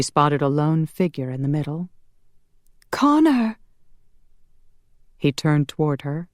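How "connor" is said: high, urgent call across a meadow. Acted. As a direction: call his name out high. Calls to a distant figure.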